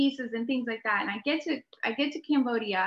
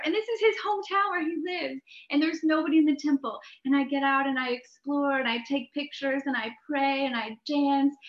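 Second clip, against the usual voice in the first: normal talking voice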